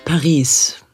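ordinary talk, nothing marked

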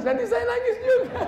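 high, laughing squeak. Acted. Preacher joking he'd cry with the parents, giggling high. Jokes he will cry too.

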